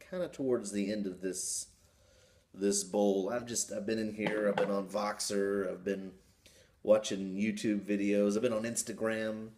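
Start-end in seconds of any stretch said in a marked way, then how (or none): 0.1-6.1 speaking around a pipe; talking with pipe in mouth. Real, not acted. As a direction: pipe stem between lips. Seen 0: Pipe stem at lips, hand-held, from 1.6s to the end.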